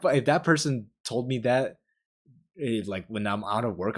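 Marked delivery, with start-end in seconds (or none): none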